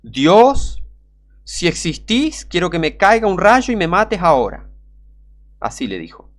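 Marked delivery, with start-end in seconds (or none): 0.0-4.7 loud, raised-pitch dare flung at heaven; declaimed challenge, drawn out at first; imitated challenge to God. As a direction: call up to God, acting out a defiant dare. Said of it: "Y miró para arriba, abrió los brazos y dijo".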